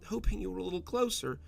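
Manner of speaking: wife breaks news, voice breaking apart; words breaking apart. A narrator's reading. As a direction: let the words crack. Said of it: "Her words broke apart as she spoke".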